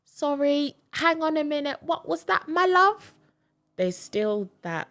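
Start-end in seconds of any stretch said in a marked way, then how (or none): 0.0-3.1 high, loud, fussy British "my love" voice; mock British phone operator. Operator answering mom's call, high and baffled. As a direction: play the puzzled operator who can't follow. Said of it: "my mom will be on the phone".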